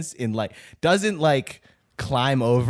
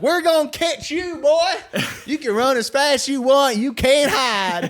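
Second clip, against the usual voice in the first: southern deputy; 5 dB louder than usual. Retold or imitated. Deputy taunting fleeing teens, hammed-up Southern accent. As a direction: ham up a Southern deputy chasing kids. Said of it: "Some southern deputy be like".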